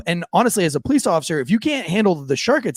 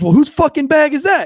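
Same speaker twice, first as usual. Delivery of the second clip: high, loud sergeant's demand; exasperated yell; 11 semitones higher, 6 dB louder than usual. Retold or imitated. Drill sergeant asking the kid once more, exasperated yell. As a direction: blow up at the repeated wrong answer. Sergeant losing patience, question escalates.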